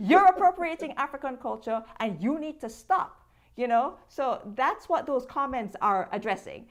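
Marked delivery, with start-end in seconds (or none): 0.1-3.1 loud, raised-pitch mock accusation; imitated scolding. Quoting the commenters' complaint, loud mock scolding. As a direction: tell someone off in a commenter's voice.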